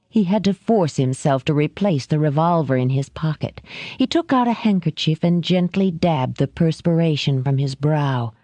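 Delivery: ordinary delivery, nothing marked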